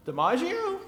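small, high, soft questioning voice; puzzled talking dog. Acted. Dog looking up at its owner outside, softly puzzled. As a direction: ask it meekly, high and quiet. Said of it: "dog looks up at the man and goes".

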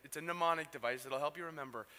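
ordinary talk, nothing marked

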